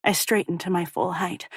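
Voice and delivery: usual speaking voice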